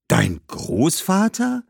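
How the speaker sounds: hoch und langsam, staunend gefragt; überrascht. Acted. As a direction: kann es kaum glauben. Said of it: "riss vor Überraschung die Augen auf". (Hannibal staunt über Badbals Großvater)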